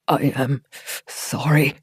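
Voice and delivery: faint gasp, lightly voiced; a touch of shivering gasp on the words; gasping through chattering teeth. Doran, freezing on the shore, a mild gasped apology. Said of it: "The words came out as a gasp through chattering teeth".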